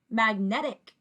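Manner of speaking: "magnetic" blended smoothly into one word; fast together. Shown as a demonstration. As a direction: run the three syllables of "magnetic" together. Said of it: "blend them fast together".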